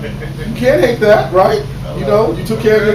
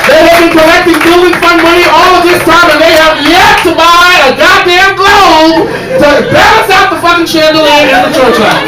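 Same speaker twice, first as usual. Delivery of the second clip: loud, high outraged rant; outraged shout; 8 semitones higher, 5 dB louder than usual. Acted. Yelling to the audience about the unfixed chandelier, outraged. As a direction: yell it at the broken chandelier, furious.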